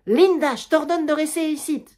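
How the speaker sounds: crié, plus aigu et plus fort; ordonne-lui de rester, sans appel; ordre crié. Acted. Germaine ordonne à Linda. (la mère ordonne à Linda de rester, en criant)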